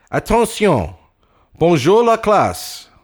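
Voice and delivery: high, loud, slow command to the class; teacher calling the room to order; old French teacher. Acted. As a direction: snap them to attention, then greet. Teacher demands attention.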